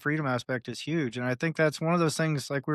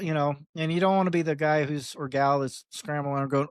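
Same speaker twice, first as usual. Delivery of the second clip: ordinary talk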